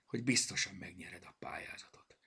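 plain reading voice

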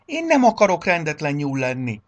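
high, loud stubborn protest; childlike obstinate refusal. A narrator's reading. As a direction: insist like a tearful little one. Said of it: "ismételte makacsól".

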